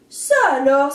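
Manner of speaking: loud astonished exclamation; surprised. A narrator's reading. Rooster amazed by the rain, loud surprised. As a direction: burst out in surprise. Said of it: "s'étonne Petit Coq".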